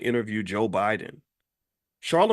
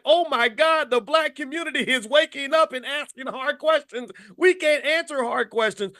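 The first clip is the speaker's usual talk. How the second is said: high-pitched alarmed wail; hammed-up panicked handler; mock panic; 12 semitones higher than usual. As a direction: imitate someone freaking out under questioning. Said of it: "You are in panic mode right now".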